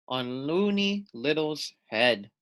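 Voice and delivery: ordinary delivery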